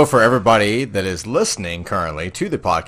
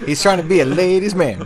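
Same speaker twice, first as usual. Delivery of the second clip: ordinary delivery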